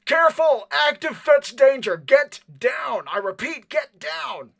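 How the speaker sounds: high, loud shouted alarm; called-out warning. Acted. Operator on the far side warns of fetch danger, shouting. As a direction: yell an urgent warning across the room. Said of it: "would call out".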